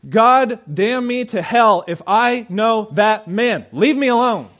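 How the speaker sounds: loud, high, slow oath of denial; furious swearing. Retold or imitated. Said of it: "He began to swear and invoke curses".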